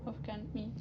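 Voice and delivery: ordinary talk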